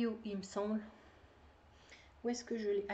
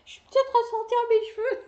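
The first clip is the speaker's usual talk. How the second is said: high, loud shocked cry at her own reflection; dismayed outburst; 10 semitones higher, 9 dB louder than usual. Real, not acted. Knitter shocked by her look on camera, high loud exclaim. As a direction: exclaim in horror, pitched up.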